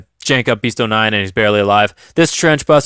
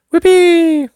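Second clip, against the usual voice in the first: excited whoop; 5 dB louder than usual. Real, not acted. Cheering the game result.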